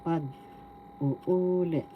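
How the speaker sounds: Pag-uulit drawn out slowly, syllable by syllable; slow Pag-uulit, leaning on the second "u"; stress on third syllable. Shown as a demonstration. Teacher introducing the Tagalog for retell, stress on third syllable. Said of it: "The third syllable has the accent".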